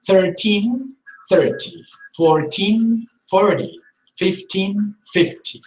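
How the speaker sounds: put-on "Thirteen" rising on teen, "thirty" falling on thir; stress contrast. Shown as a demonstration. Teacher running the number pairs again. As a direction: drill the pairs crisply, teen high. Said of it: "let us not get confused".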